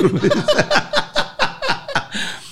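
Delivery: squealing burst of laughter; very high laugh. Real, not acted. Husband laughing at his wife's insult, squealing laugh. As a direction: shriek with laughter.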